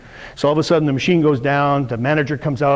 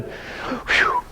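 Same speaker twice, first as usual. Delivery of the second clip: breathy high whistle of relief; relieved whew; 6 dB quieter than usual. Retold or imitated. Staff after the machine restarts, relieved whew. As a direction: let the air out like a crisis just passed.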